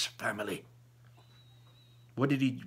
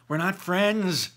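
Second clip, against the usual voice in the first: defensive; 8 semitones higher, 5 dB louder, slower than usual. A narrator's reading. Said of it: "I defend myself".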